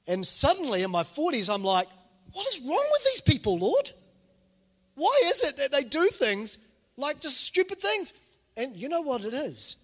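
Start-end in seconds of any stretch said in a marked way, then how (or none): 2.3-8.1 very high, mock-exasperated complaint; retold exasperation, pitch soaring; exasperated plea. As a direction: whine it up to God in disbelief.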